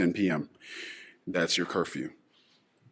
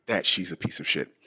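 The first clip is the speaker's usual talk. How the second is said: ordinary talk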